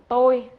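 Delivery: "Tôi" shot out loud and high; "Tôi" said loudly with strong emphasis; emphatic I. Shown as a demonstration. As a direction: hammer the word "Tôi" as the contrast. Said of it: "ví dụ như nếu mà anh ta muốn nói là".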